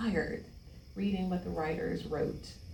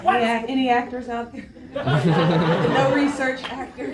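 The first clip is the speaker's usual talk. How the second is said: talking through laughter; giggling the words out; laughing; 5 semitones higher, 14 dB louder than usual. Real, not acted. Laughing at own joke.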